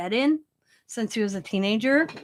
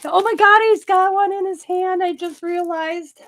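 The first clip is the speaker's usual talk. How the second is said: high, loud burst of surprise; real amused shock at a discovery; gasping at what the gnome holds; sudden realization; 8 semitones higher, 7 dB louder than usual. Real, not acted. Spots joint in gnome's hand.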